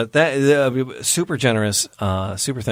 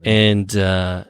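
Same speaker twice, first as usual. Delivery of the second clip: ordinary talk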